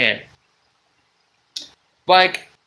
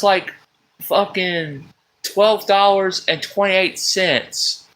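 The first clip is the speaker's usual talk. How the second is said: ordinary talk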